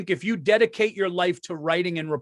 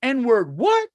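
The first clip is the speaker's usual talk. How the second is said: sharp pitch leap on what; mock Westbrook disbelief; incredulous what; 5 semitones higher, 3 dB louder than usual. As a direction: snap it back in disbelief. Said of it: "he was pissed".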